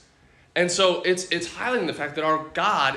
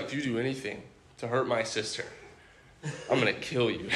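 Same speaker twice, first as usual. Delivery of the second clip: mock-menacing brother, lower and softer; dead-serious threat; 5 semitones lower, 8 dB quieter than usual. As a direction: imitate a low, quiet threat. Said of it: "he's looking at me and dead serious".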